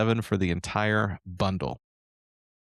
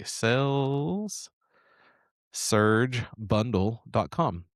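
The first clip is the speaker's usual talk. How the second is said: slow; much slower than usual. Shown as a demonstration. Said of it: "That's S A L E S".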